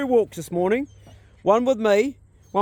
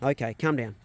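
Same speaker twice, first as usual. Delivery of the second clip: ordinary talk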